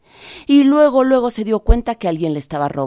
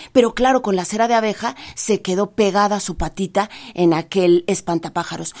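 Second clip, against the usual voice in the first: usual speaking voice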